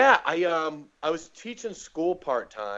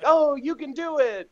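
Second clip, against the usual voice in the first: high-pitched, louder gushing cheer; mock encouragement; 13 semitones higher, 5 dB louder than usual. Classmates cheer on a weak beginner comic, mock supportive. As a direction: parody an over-supportive group. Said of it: "everybody has to clap for whatever you say".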